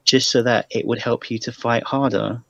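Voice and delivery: ordinary talk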